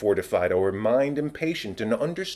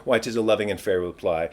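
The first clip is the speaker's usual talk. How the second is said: usual speaking voice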